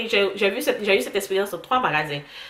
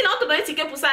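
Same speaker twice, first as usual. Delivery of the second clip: ordinary talk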